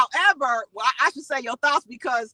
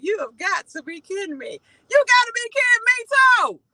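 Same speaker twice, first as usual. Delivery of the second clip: mama's exasperated disbelief, the last line louder and higher; imitating mama; 7 semitones higher, 4 dB louder than usual. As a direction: hand on hip like an incredulous mom. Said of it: "she'll put her hand on her hip and she'll be like".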